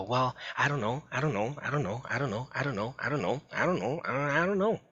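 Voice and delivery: put-on teen brushing off dad's questions, whiny and evasive; evasive kid. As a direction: keep mumbling I don't know like a stonewalling teen. Child repeating I don't know.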